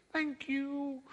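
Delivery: high little fish voice. Acted. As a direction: make it a small magical fish piping up. Talking fish thanks the man.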